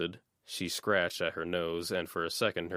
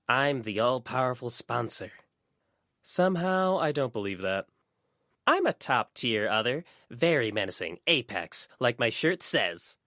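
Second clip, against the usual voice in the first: higher, louder playful fairy voice; mock-boastful little fairy; hammed-up menacing brag; fairy character; 6 semitones higher, 5 dB louder than usual. A narrator's reading.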